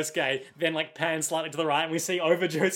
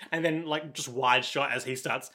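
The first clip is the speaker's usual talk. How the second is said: normal talking voice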